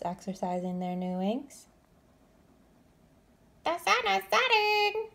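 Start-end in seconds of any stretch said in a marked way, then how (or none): 3.6-5.1 high, louder chirpy warning; a baby bird calling the others home; warning chick. A narrator's reading. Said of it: "warned Flitter".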